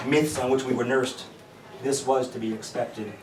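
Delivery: declaimed poem with ringing key phrases; measured poem reading with loud peaks; poetry recitation. Acted. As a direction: read it as verse to an audience, pausing between phrases.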